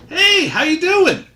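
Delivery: high loud friendly call. Acted. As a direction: shout it up to space. Shouting up to satellite.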